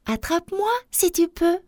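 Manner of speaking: high-pitched playful challenge; high storybook taunt; taunting little galette. A narrator's reading. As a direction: dare them in a high little voice.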